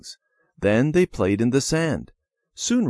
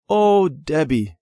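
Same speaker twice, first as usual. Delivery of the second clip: disappointed sigh; 6 semitones higher, 5 dB louder, slower than usual. A narrator's reading. Jean sees picked flowers.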